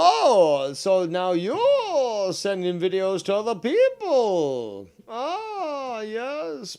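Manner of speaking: high, slow, mocking gotcha; sarcastic. Real, not acted. Catching his rival out with a high sarcastic jab. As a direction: drag it out with sneering surprise.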